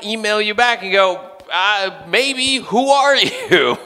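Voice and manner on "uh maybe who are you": high, slow, drawn-out puzzlement; hesitant bafflement. Acted. Professor answering a forgotten student's email, hesitant and baffled. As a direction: stall awkwardly, then ask in confusion.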